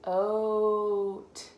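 "oat" held long and loud; stretched vowel. Shown as a demonstration. Teacher stretching "oat" for the child. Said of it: "I found".

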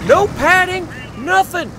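high and loud, still amazed; incredulous exclamation. Real, not acted. Son telling viewers about the suplex, loud and incredulous. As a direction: hammer home how hard the floor was.

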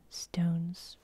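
usual speaking voice

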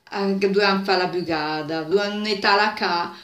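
ordinary delivery, nothing marked

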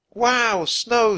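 high, loud exclamation of wonder; excited child. A narrator's reading. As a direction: cry out in delight at the snow. Annie exclaims at falling snow.

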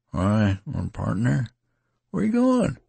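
pleading not to be left; a touch of unsteadiness; unsteady. A narrator's reading. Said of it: "he asked unsteadily".

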